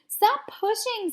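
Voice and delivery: high, slow, protesting stop pushing; annoyed high cry. A narrator's reading. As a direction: snap at the kids shoving her. Kids shoving in the dark.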